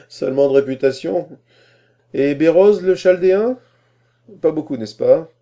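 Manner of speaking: laugh, then high rising questions; amused quizzing. A narrator's reading. Scholar quizzing young applicant on reading, amused. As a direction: test the novice with lively condescension.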